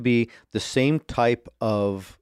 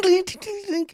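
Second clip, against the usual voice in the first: very high ting noises; ting sound effect. Retold or imitated. Imitates darts firing from trap.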